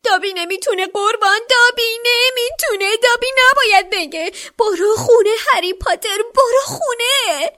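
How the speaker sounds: very high, loud squeaking elf voice; squeaky. A narrator's reading. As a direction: squeal it out like a desperate little elf. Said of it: "با صدای جیغ جیغ مانندی گفت". (با صدای جیغ جیغ مانند و بلند)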